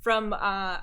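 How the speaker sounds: normal talking voice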